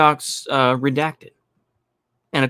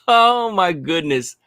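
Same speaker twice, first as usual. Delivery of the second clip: laughing; 7 semitones higher, much slower than usual. Real, not acted. Laughing at the caller's claim.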